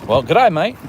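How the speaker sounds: hammed-up Australian catchphrase; mock Australian accent. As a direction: call it out in put-on Australian. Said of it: "It's from Dumb and Dumber".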